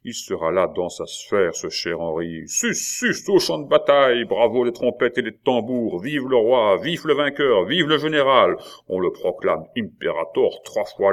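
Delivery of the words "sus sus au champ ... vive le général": rising to a loud, high rallying cry; mock triumphal cheer. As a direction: hail the general like a herald at a parade. Charles mocks a triumphal send-off.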